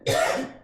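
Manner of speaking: short dry cough. Real, not acted. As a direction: cough to clear an itchy throat. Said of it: "excuse me, y'all".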